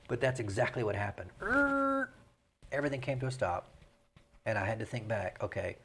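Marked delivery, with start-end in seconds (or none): 1.4-2.1 high squealing brake-screech noise, mock; cartoon screech. Mayor mimicking a meeting grinding to a halt, high mock screech. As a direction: imitate cartoon feet skidding to a stop. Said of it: "Fred Flintstone screech with his feet".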